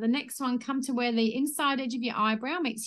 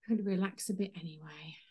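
soft whispered ending; 4 semitones lower, 5 dB quieter than usual. Shown as a demonstration. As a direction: breathe the words out. Guided tapping, soft ending.

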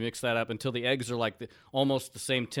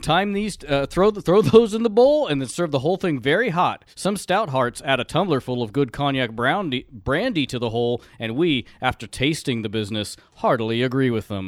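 recipe reading; 2 semitones higher, 8 dB louder than usual. Acted. Reads old recipe aloud.

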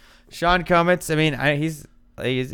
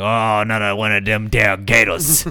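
put-on Southern accent; 5 dB louder than usual. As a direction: grumble in exaggerated Southern twang. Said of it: "I was like".

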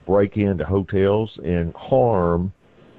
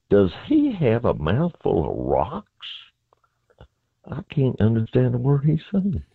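imitating his own baffled reaction; retold bafflement; 6 semitones higher than usual. Retells his baffled question to roommate.

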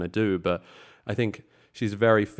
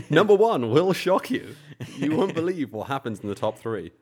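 clickbait video narrator; 7 semitones higher, 5 dB louder than usual. Acted. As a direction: tease it like a clickbait headline. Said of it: "make this slightly more clickbaity".

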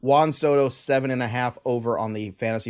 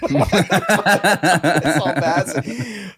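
words buried in laughter; cracking up mid-line; laughing hard; 4 semitones higher, 5 dB louder, much slower than usual. Real, not acted. Laughing at tweet.

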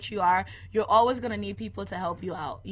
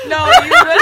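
high, loud burst of laughter; can't stop laughing; laughing through the words; laughing hard; 9 semitones higher, 12 dB louder than usual. Real, not acted.

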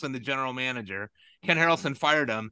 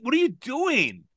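incredulous; 9 semitones higher, slower than usual. Real, not acted. Exasperated at team hire.

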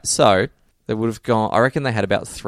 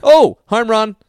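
loud, high, excited home run call; ear-splitting cheer; 12 semitones higher, 7 dB louder, much slower than usual. Real, not acted. Excited fan breaking the co-host's ears over a home run, loud cheer. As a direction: burst out cheering at the game. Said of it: "You broke my ears there".

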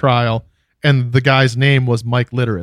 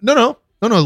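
ordinary talk

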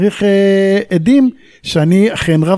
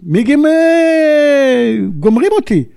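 retold shout for help; 9 semitones higher, slower than usual. Veteran retells shouting on the emergency channel, high drawn-out yell. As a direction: yell for help as the jets close in. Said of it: "ואני צועק".

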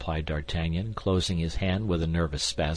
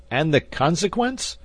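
high, louder anxious question; great anxiety; 11 semitones higher, 6 dB louder than usual. A narrator's reading. D'Artagnan asking the gambler what followed, in great anxiety. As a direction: press anxiously for the outcome. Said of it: "in great anxiety".

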